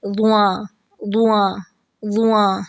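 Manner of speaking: وواں with rounded lips and an added nasal; rounded nasal mistake. Shown as a demonstration. Teacher imitating the lip-rounding error. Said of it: "تو وہ کیا ہو جاتی ہے؟".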